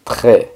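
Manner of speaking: Très with the R gargled in the throat; throaty French R. Shown as a demonstration. Teacher showing how it should be, Très with gargled R. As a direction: say Très as "treh" with guttural R, silent S. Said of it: "entonces así debe ser".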